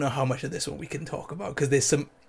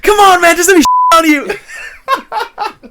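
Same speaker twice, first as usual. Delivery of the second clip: much higher, louder, slow hammed-up plea with laughing; mock frustrated plea; 13 semitones higher, 9 dB louder, much slower than usual. Man begs his girlfriend, laughing and whining. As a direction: whine it while cracking up. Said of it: "he just goes".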